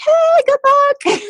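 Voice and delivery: very high, slow, drawn-out well-wishing; chirpy teammates wishing luck; hammed-up bubbly greeting; sing-song cheer. Breaststrokers cheering her on.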